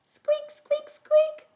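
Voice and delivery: tiny, very high squeaks; mouse squeaks. Pretend. Storyteller plays the little mouse for young listeners, high squeaking.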